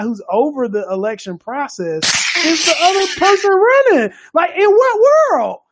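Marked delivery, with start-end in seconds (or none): 2.4-5.6 incredulous outcry. Real, not acted. Friend, incredulous about the Georgia official, outraged loud disbelief. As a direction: pitch jumps way up, louder, in disbelief.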